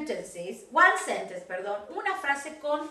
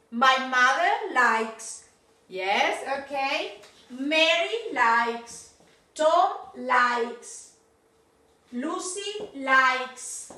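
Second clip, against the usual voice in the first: likes with a clear final S, slowly; teacher drilling likes; much slower than usual. Shown as a demonstration. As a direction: model the S on likes. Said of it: "le agregamos la S".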